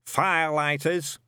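put-on high, barking old sergeant; old witchfinder sergeant. A narrator's reading. Shadwell drilling Newt through the kit list, barking. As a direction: bark out the checklist like a drill sergeant.

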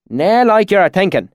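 high, broad country-dialect swineherd; rustic swineherd. A narrator's reading. Swineherd warning a traveller, in rustic dialect. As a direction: play a frank country pig farmer.

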